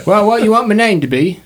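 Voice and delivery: put-on high orphan voice with an accent; questioning orphan. Orphan asks for a name.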